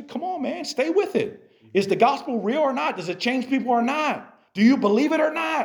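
high, fired-up pep talk to yourself; pumping yourself up, almost yelling; self pep talk. Pretend. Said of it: "You don't have to yell it to yourself".

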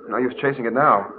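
ordinary talk, nothing marked

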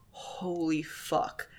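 hushed dismay. Retold or imitated. Voicing shock at Dean's deal, hushed dismay. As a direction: imitate stunned disbelief under the breath.

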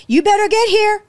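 pressing her to come right away; urgent retold phone call. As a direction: relay the caller's urgency. Rescue woman phones her urgently.

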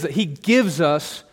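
slow, with "gives" drawn out; stress on gives. Shown as a demonstration. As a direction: slowly hit "gives" in "He gives us". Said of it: "It's on gives".

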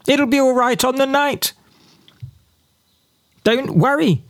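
high, airy mocking voice; mock breezy reassurance. Commentator mimics Brexiteers brushing off worries, high breezy mockery. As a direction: chirp it like a careless optimist. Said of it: "they said".

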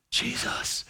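hushed whisper of the name; reverent whisper. Acted. Paul addressing Jesus, hushed reverent whisper. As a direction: breathe the name in awe. Paul's voice begins before Jesus.

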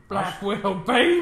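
loud, high mock brag of a cheer; hyped-up cheer. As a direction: belt it out like a proud boast. Quoting Cap in a hyped voice.

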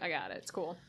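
low, soft and offhand; casual low mutter. Retold or imitated. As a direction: shrug it off quietly. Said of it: "she's like".

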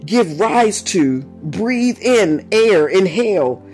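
low, slow definition with long spacing; slow, deliberate. Shown as a demonstration. Preacher defining the urge for listeners, slowly and deliberately. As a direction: set down each phrase on its own. Definition continued, slowed for emphasis.

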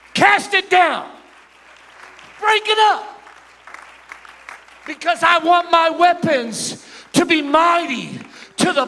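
loud, slow, high commanding cry; shouted prayer. Acted. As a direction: shout it out to God. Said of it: "get direct with God and say".

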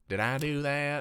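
put-on sitcom nerd voice; Urkel. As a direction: do the goofy catchphrase impression. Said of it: "That kinda sounded like Urkel".

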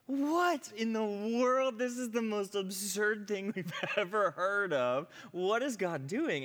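high, loud, incredulous outburst; astonished disbelief. Retold or imitated. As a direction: blurt it out in laughing, drawn-out amazement. Retells group's disbelief at videos.

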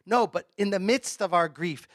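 usual speaking voice

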